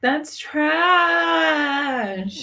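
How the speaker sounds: high, drawn-out wail of disgust; outraged disgust. Real, not acted. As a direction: stretch the last word in protest. Outraged reaction to the referee story.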